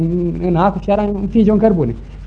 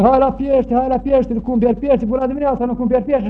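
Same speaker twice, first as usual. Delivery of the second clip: street hawker call; 7 semitones higher than usual. Retold or imitated. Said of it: "și strigă".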